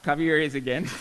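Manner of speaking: ordinary delivery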